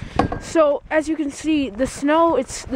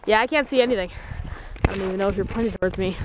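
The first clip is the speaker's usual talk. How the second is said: ordinary talk, nothing marked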